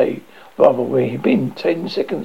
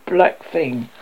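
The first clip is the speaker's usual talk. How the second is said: blunt; 6 semitones higher, 3 dB louder, much slower than usual. A narrator's reading. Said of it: "said the Arch-Chancellor bluntly".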